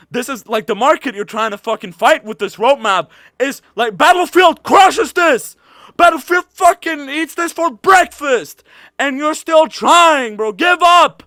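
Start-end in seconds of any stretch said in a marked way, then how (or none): 4.0-11.2 higher and louder, slow angry jabs; angry shout. Real, not acted. Streamer yells at the developers to quit, angrily. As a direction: shout "give up" at them. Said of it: "this is making me so angry".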